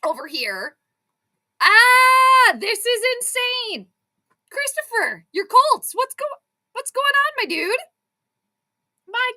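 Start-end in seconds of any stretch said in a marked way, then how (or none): 1.6-9.3 loud high yell, then drawn-out disbelief; excited screaming. Real, not acted. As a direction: cry out high at the wild game. Screams at the game.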